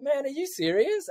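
as if you can't believe what you just heard; incredulous. Retold or imitated. Teenager to older mates about their plans, incredulous.

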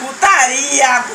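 loud, slow drawn-out cry; loud exclamation. Real, not acted. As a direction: blurt it out loud. Exclamação alta em bagunça. (exclamação bem alta)

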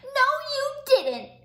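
loud, high angry snap; sharp child retort; snapping. Acted. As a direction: snap back at the monster. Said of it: "snapped Sam".

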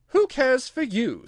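high, slow, scornful challenge; scornful girl talking back; defiant. A narrator's reading. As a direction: dismiss them loudly and boldly. Alice defies the court.

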